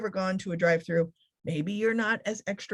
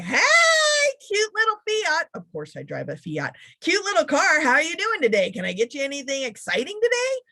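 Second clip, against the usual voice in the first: bubbly high, louder clerk voice; perky barista; 8 semitones higher, 4 dB louder than usual. Retold or imitated. Speaker imitating a sassy drive-through clerk, high and perky. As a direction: sell it like an over-cheerful barista. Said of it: "if they say".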